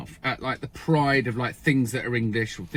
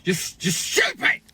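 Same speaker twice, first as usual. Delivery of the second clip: mock disdain; slower than usual. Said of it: "I'm thinking".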